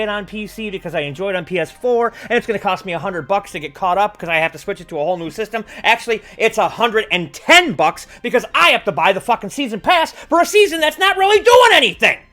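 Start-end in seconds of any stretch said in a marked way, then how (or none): 5.8-12.2 angry rant. Real, not acted. Ranting, building to loud.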